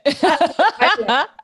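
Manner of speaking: high, loud laugh; burst of laughter. Real, not acted. Laugh after guilt remark.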